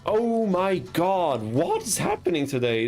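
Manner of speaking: high, slow anguished whine; drawn-out cry of disbelief; despairing lament. Real, not acted. Loses duel, despairing.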